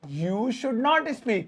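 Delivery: "you should not speak" word by word; slow, separated teacher read; very slow dictation. Shown as a demonstration. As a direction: spell it out slowly. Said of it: "translation demo".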